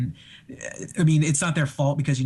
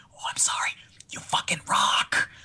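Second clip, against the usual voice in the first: sorry and starstruck, whispered; excited whisper; 4 dB quieter than usual. Retold or imitated. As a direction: whisper an apology to your idol. Whispering apology on the bus.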